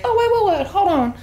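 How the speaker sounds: high startled gasp of words. Retold or imitated. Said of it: "then she's like".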